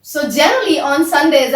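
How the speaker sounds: normal talking voice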